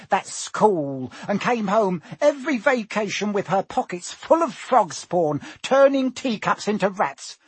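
high, bitter aunt in a rant; indignant, scornful tirade; shrieking Aunt Petunia. A narrator's reading. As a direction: spit out years of resentment. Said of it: "shrieked Aunt Petunia suddenly".

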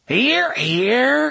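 high, loud cheer; cried out. Acted. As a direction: crow it out. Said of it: "Gasher cried".

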